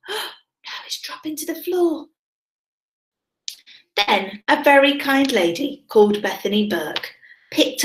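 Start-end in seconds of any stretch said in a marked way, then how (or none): 0.1-2.1 put-on hushed whisper to the children. Teacher to class as the bear falls, in a whisper. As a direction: breathe it so they lean in.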